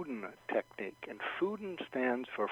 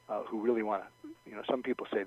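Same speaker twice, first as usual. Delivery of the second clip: normal talking voice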